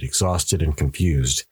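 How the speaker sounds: ordinary delivery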